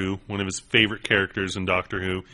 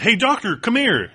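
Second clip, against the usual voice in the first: much higher, raised call; 12 semitones higher than usual. Retold or imitated. Fan calling a Doctor Who cosplayer over, high call. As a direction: hail him across the crowd. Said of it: "I, I said".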